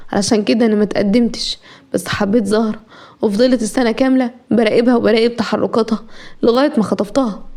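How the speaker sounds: usual speaking voice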